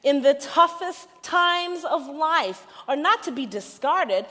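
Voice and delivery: building sermon climax; preaching at full force. Acted. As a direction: lift the room with conviction.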